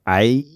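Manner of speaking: A held long, Aussie style; lingering Australian A; drawn-out syllable. Shown as a demonstration. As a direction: let the "A" hang on. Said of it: "let 'em hang on for ages".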